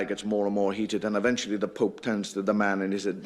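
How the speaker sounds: normal talking voice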